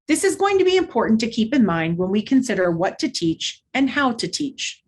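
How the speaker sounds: usual speaking voice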